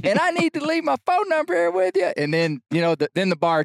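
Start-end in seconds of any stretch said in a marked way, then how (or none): 0.0-2.1 high strained shout; shouting into the bar; yelling louder. Retold or imitated. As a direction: keep belting it over the crowd. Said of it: "so I yell even louder".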